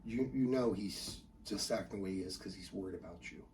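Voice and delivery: low, hushed reassurance; very soft, tender explaining; consoling mom. Acted. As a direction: soothe a child late at night. Mom consoling son at night.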